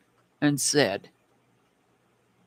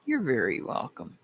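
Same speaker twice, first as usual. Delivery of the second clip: softer repeat; 4 semitones lower, 7 dB quieter than usual. Real, not acted. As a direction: repeat "You're very welcome" softer, trailing down.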